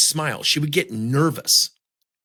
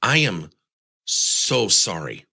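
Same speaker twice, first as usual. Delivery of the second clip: sorry; 5 semitones lower, slower than usual. Retold or imitated. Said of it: "he said".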